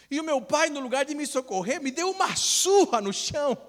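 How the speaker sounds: high, loud storytelling punchline; comic exclaiming. Retold or imitated. Friend retells being beaten after an accident, comic loud exclaiming. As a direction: exclaim the absurd outcome in mock outrage.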